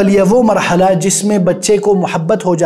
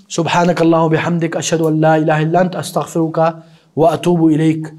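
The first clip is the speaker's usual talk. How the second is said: closing prayer; 3 semitones lower than usual. Real, not acted.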